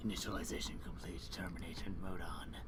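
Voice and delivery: low whispered killer robot; menacing machine, hushed and low; Terminator robot. Acted. Terminator robot voicing Miku.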